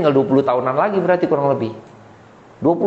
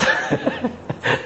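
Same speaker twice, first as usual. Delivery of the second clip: short high chuckle; amused chuckle; 10 semitones higher, 5 dB quieter than usual. Real, not acted. Preacher joking with the congregation about choices, amused chuckle. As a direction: let a light laugh slip out. Chuckles at his own joke.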